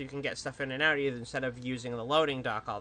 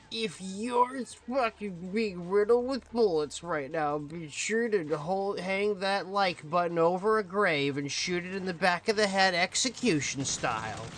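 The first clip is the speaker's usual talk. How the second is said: fake YouTuber voice; 4 semitones higher than usual. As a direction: ham it up like a clickbait YouTuber. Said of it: "the fake YouTuber voice".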